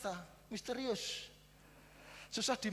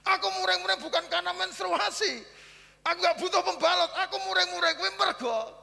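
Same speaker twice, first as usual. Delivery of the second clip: higher, louder, scolding wife's outburst; mock angry wife; 12 semitones higher, 9 dB louder than usual. Wife scolding her husband over the missing brush, mock angry. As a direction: rant like the annoyed wife.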